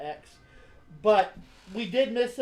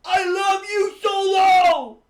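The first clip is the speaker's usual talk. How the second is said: much higher, shouted with pride; loud proud shout; 11 semitones higher, 9 dB louder, slower than usual. Retold or imitated. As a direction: belt his love out at full volume. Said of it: "my best impression of how he used to do it".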